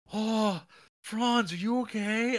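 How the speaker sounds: high, loud exaggerated fretting; mock concern. Everyone fussing over how Franz is doing, mock concern. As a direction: gush with overdone worry, pitch way up. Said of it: "Everyone's really concerned".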